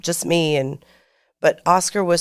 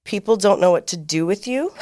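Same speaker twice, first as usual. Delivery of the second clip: ordinary talk, nothing marked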